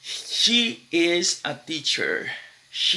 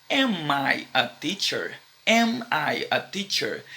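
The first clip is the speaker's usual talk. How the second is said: slow question model; slower than usual. Shown as a demonstration. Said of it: "você vai falar".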